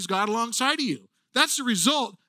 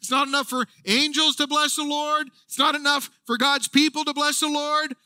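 higher and louder, insisting it's not enough; building excitement; 5 semitones higher, 3 dB louder than usual. Retold or imitated. Preacher voicing David wanting more praise, excited. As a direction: push harder with each line. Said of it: "And he goes".

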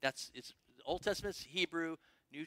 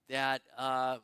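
ordinary delivery

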